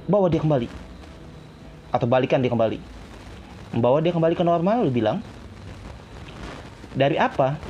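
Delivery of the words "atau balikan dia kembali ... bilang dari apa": ordinary talk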